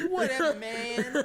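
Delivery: slow laughing brush-off; laughing dismissive. Retold or imitated. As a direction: shrug it off laughing. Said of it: "I was like".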